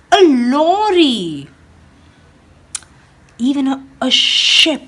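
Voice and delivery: lively storybook read-aloud for a class; animated picture-book reading; reading to children. Acted. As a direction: read it like storytime for little ones. Teacher reads picture book aloud.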